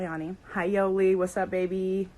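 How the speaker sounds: ordinary talk, nothing marked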